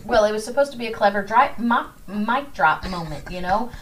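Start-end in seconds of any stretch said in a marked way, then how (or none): none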